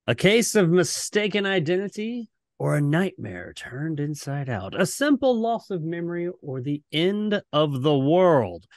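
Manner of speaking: dramatic TV host narration, rising on the questions; ominous announcer voice, playing it up; Serling closing narration. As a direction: read it like the closing of a creepy anthology show. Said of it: "do the closing narration".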